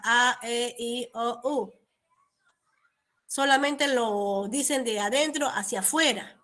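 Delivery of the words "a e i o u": vowels without moving lips. Shown as a demonstration. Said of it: "sus labios no se mueven para nada".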